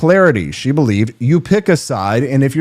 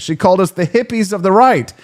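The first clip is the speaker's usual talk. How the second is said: usual speaking voice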